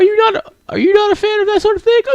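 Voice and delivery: loud, very high falsetto question; squeaky-high bookish girl; hesitant shy girl asking. Acted. Voices Yuri asking.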